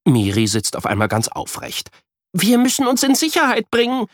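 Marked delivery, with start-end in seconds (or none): 2.3-4.1 put-on higher, urgent little girl; rally him to safety; urgent child. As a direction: pretend danger, eager. Miri's urgent game.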